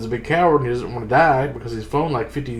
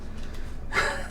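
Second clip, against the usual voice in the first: soft breathy laugh; 9 dB quieter than usual. Real, not acted. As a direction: laugh it off quietly. Laughs after mocking the book.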